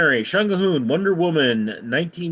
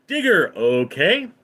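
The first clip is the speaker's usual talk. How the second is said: ordinary delivery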